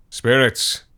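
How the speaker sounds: chief spirit voice. A narrator's reading. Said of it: "said the chief spirit".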